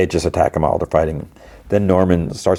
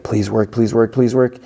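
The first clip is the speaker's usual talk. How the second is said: higher, urgent repeated mini-plea; desperate pleading; 5 semitones higher than usual. Retold or imitated. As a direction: beg it to work, mock-panicked. Desperate plea while being chased.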